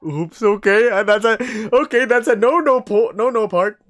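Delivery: loud, high, flustered warning; nervous high voice. Acted. Nervous wolf voice, louder.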